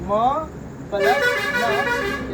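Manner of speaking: stretched-out classroom sum; drawn-out teaching call. Shown as a demonstration. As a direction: stretch "plus" long and high, very slow. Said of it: "इसको क्या लिखेंगे?".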